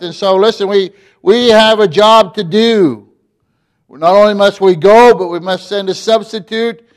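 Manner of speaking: preacher pressing the congregation, rising and loud; preaching at full force. Acted. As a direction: build it up and drive it home to the congregation.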